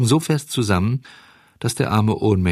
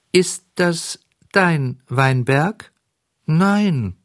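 hohe, langsame Käferstimme; Leuchtkäferchen; 9 semitones higher, 3 dB louder, much slower than usual. Acted. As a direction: ein kleines Tierchen fragt streng nach. Käfer verhört Bengele. (Leuchtkäferchen fragt Bengele hoch und langsam)

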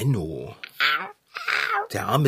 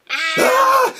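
startled scream; 6 dB louder than usual. Acted. Startled by the cat sneaking up.

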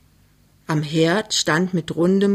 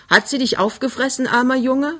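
higher, playful teasing; high spirits; 7 semitones higher than usual. Acted. Daughter teases her shaken fiancé, in high spirits. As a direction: tease him with mock pity. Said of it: "Sie ist bester Stimmung".

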